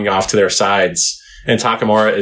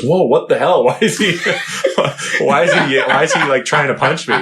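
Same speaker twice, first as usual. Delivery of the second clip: higher, confused and cracking up; bewildered, laughing; 4 semitones higher than usual. Retold or imitated. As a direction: play a dazed fighter while laughing at him. Dazed boxer waking mid-fight.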